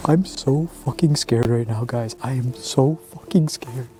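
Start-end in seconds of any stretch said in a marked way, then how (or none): none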